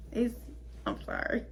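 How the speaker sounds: very soft, breaking off; barely voiced, halting apology; hushed apology. Real, not acted. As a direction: voice drops away mid-thought. Breaks off after kittens' gruesome death.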